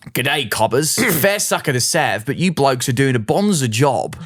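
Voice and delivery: a light, hammed-up Australian accent read aloud; playing up a broad Australian accent, lightly. Reading a listener's email to the co-host in a mock Australian accent. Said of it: "I should probably do the accent".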